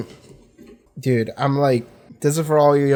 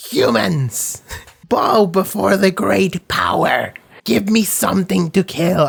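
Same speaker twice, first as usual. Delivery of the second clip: imitating Power; 5 semitones higher than usual. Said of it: "she goes like".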